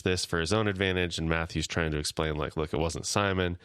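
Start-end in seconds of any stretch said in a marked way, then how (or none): none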